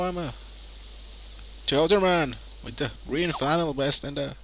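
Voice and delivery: normal talking voice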